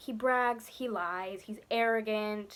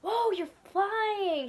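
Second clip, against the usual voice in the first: higher, slow drawn-out amazement; amazed whoa; 9 semitones higher, much slower than usual. Retold or imitated. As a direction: marvel at the flying kid. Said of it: "instead of being like".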